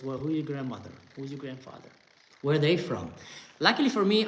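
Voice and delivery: ordinary delivery, nothing marked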